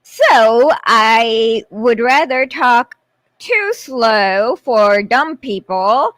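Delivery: deliberately slow, drawn-out speech; slowed right down on purpose; slow motion. Real, not acted. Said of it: "yes, I am talking in slow-mo".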